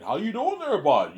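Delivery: high, loud Canadian greeting; hardcore Canadian accent. Acted. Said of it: "That's where you get the hardcore Canadian".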